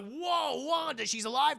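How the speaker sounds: high-pitched mock astonishment; acted amazement. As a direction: gasp it out high, amazed for show. Acted amazement at Wanda alive.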